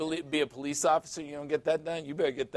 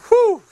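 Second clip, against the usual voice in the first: relieved whew; 13 dB louder than usual. Retold or imitated. Relief in retold chat.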